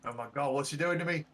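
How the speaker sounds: high, loud, flustered captive; acted panic. Captive reacting to a spell being cast on him, acted panic. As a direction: babble upward in mock alarm.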